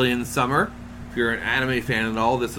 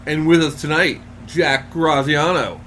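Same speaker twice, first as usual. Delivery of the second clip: big showbiz announcer intro; 3 semitones higher than usual. Acted.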